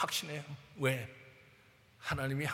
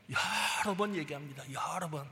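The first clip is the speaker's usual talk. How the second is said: usual speaking voice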